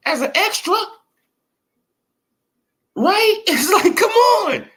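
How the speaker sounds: boxing vlogger laughing in disbelief at fighters; exasperated. Real, not acted. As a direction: throw up your hands, laugh and shout it. Exasperated rant about fighters' promo.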